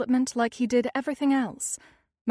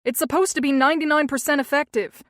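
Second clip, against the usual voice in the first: loud, fast, higher angry voice; louder, angry; 4 semitones higher, 7 dB louder, faster than usual. A narrator's reading. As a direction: raise the voice even more. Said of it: "his voice getting louder".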